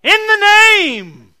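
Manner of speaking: huge shouted "In the name" with pitch leaping up; screamed name. Shown as a demonstration. Preacher mocking a showy scream of the name. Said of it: "I didn't go".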